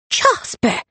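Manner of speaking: high, sharp exclamation of a name; high-pitched shock; startled cry. A narrator's reading. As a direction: cry out on finding him there. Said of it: "Honoria cried".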